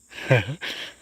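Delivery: a bright, high burst of happy laughter; real delighted laugh. Real, not acted. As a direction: laugh out in joyful surprise. New kitten spotted, delighted.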